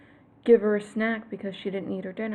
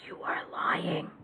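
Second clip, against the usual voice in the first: whisper; 5 semitones lower, 6 dB quieter, slower than usual. Real, not acted. Accusing viewers quietly.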